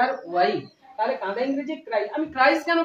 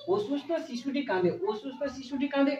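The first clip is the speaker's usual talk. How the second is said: softer repeated model sentence; 5 dB quieter than usual. Shown as a demonstration. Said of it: "শুধু অসুস্থ বলেছি".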